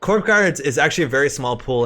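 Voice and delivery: ordinary talk, nothing marked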